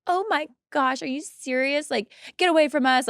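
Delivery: high, outraged imitation of the girlfriends; mock offended girlfriends. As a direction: play up their shocked indignation. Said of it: "But they were like".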